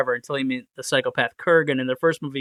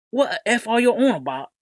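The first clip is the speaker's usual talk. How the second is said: rough Cockney voice, pitched up; Cockney accent; 5 semitones higher than usual. Retold or imitated. Imitating a TikTok commenter in a Cockney accent. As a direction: do a British Cockney bloke asking what's going on. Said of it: "like, a Cockney or British accent".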